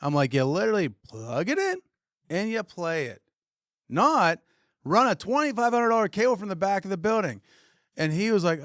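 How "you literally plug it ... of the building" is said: rising higher and louder to the end; building frustration. Retold or imitated. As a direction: climb from sarcasm to outrage. Retold rant at the rep.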